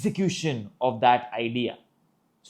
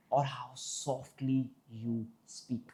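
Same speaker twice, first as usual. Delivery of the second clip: much softer, slow delivery; soft demonstration; 11 dB quieter, much slower than usual. Shown as a demonstration. Speech coach defining volume, softly. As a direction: drop right down, slow and soft.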